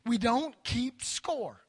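drawn out, word by word; slow emphatic. Acted. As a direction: hammer the step home slowly. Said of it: "So the second step is this".

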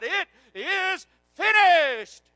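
slow, high, full-voiced shout; preacher re-enacting a triumphant cry, word by word; loud cry. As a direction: shout it out slowly, stretching "finished". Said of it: "He cried".

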